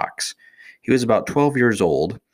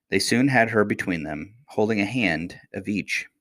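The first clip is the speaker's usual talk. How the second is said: usual speaking voice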